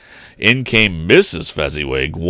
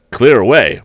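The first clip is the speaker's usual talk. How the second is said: hearty call; 4 semitones higher, 7 dB louder than usual. Acted. Echoing Fezziwig's call.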